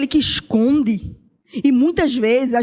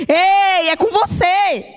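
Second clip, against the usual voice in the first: raised, pointed call; 5 semitones higher, slower than usual. Real, not acted. Preacher calls out the listener directly, raised and pointed.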